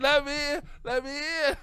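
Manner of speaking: high, loud banging plea; frantic pleading. Retold or imitated. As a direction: beg at the door to be let in. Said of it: "I was like".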